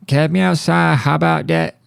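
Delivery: high-pitched catchphrase impression; Catch Me Outside girl. Host quoting a viral girl's catchphrase as the episode wraps, mock challenge. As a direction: throw out the catchphrase high and cocky. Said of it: "Whatever happened to Catch Me Outside, girl?".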